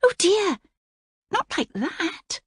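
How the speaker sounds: high alarmed cry; flustered princess. A narrator's reading. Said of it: "cried Princess Cora".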